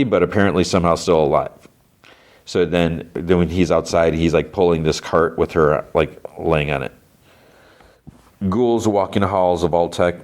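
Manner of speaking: normal talking voice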